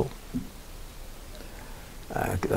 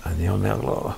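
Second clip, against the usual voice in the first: normal talking voice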